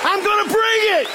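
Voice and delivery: high-pitched preacher's battle cry; rousing defiant shout. Acted. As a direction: shout it out as a fired-up vow. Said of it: "rise up and say".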